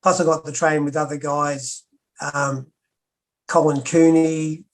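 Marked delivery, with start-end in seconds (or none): none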